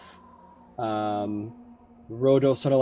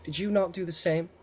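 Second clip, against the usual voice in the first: imperious goddess; 2 semitones higher than usual. Acted. Hera reminds Poseidon of his own past, pointed and haughty. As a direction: needle him with haughty questions.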